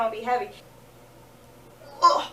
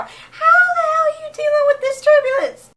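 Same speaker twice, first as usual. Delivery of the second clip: much higher, loud indignant yell; exasperated shout; 12 semitones higher, 9 dB louder than usual. Real, not acted. Shouting in disbelief at the steady flight attendants.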